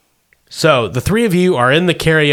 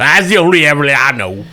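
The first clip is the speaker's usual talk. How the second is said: put-on old prospector; 3 dB louder than usual. Wilbur voiced by the GM.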